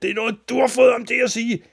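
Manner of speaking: high, fast accusing shout. A narrator's reading. Father leaps up accusing his son, loud shout. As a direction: yell it in one loud rush. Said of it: "sprang han op med et råb".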